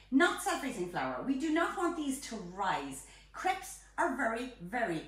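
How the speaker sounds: ordinary talk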